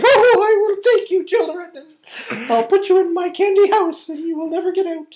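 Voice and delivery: high-pitched storybook witch voice; old-timey witch. Acted. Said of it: "that was like an old-timey witch".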